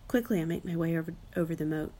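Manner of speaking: plain reading voice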